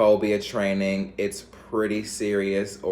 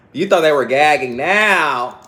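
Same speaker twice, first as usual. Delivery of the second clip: high, loud sassy put-on voice; loud, higher diva taunt; 6 semitones higher, 10 dB louder than usual. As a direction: tease them with a diva squeal.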